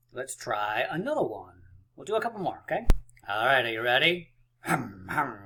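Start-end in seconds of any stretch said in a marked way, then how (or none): 4.6-5.4 low humming engine revs; revving engine noise. Pretend. Teacher readying the class for a peek, low engine hums. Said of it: "rev your engines".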